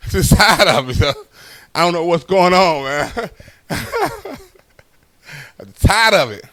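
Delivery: normal talking voice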